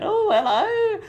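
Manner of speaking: very high drawn-out greeting; costumed character. Acted. Mascot greeting a small child, squeaky high. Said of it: "came dressed up to me in one of those massive costumes".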